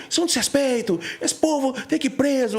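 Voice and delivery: imitating the outraged religious people insulting the protesters; mocking indignant churchgoers. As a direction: parody the scandalized religious crowd. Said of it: "E xingam".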